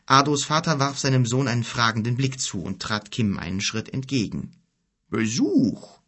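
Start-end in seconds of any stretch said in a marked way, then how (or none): 5.1-6.0 put-on old father. A narrator's reading. Old father notices a visitor.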